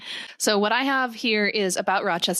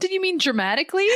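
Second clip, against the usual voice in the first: laughing mock question; 10 semitones higher, faster than usual. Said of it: "and I'm like".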